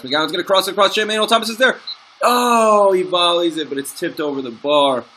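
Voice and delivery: high, loud rising call of the chance; excited commentary. Real, not acted. Player calling his own match chance, excited shout. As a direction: build up to the volley with a shout.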